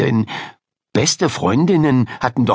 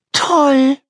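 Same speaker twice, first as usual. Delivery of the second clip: stunned delight; 4 dB louder than usual. Acted. Ida answers the surprise.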